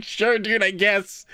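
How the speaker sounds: high, hesitant mock consent; retold bemused go-along; awkward agreement. Retells awkward agreement to odd request.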